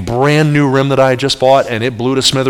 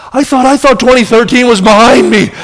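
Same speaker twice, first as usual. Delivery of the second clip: high-pitched comic exasperation; hammed-up wail of frustration; exasperated cry; 10 semitones higher, 4 dB louder than usual. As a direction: cry it out in mock despair. Exasperated at yet another blown tyre.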